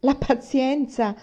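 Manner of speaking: wife's second, angrier echo; slow outraged repeat. A narrator's reading. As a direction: spell out "pazienza" in scorn. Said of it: "esclamò mamma".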